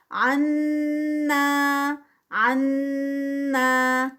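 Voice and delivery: عنا sung out slowly, nasal ghunna on نون, clean two-count الف; tajweed recitation. Shown as a demonstration. Teacher repeats عنا for students as recitation. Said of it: "جب ہم عن پہ آئیں گے تو نون کا غنہ ہے".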